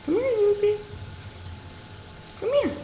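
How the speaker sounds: very high coaxing pet voice; coaxing the dog. Real, not acted. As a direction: coax the dog in a high sweet voice.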